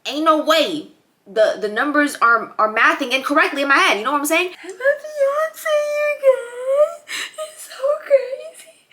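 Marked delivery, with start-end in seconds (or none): 4.6-8.9 high teary slow voice; fighting back happy tears; near tears. Real, not acted. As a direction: voice breaking with emotion. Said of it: "I could cry thinking about it".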